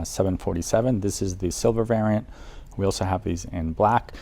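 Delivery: ordinary talk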